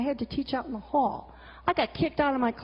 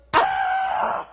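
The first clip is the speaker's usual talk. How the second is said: sharp cough; 4 dB louder than usual. Real, not acted. Loud sudden noise.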